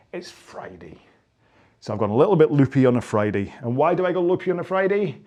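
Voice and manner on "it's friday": very soft, low hush; hushed reveal. Pretend. Coach revealing why he is loopy, playfully hushed. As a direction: drop right down to share a secret. Mock-secret reveal of the day.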